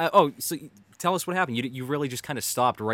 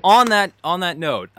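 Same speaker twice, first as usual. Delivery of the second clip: much louder and higher, seizing the floor; loud cut-in; 7 semitones higher, 12 dB louder, slower than usual. Real, not acted. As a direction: jump in loudly to wrap it up. Host cuts in to change topic.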